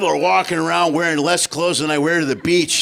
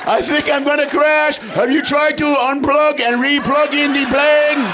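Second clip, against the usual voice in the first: panicked caller; 8 semitones higher, 5 dB louder, slower than usual. Retold or imitated. Comic crash call dialogue.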